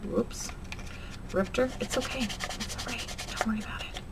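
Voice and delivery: whispered reassurance to herself after a rip; hushed self-soothing. Real, not acted. Crafter softly calming herself after tearing the paper, whispered. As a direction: murmur it's okay under breath.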